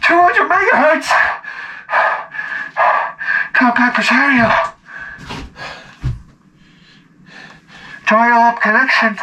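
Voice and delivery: announcing the computer to the crowd; grand announcer. Retold or imitated. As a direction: present it like a ringmaster. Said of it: "Ladies and gentlemen".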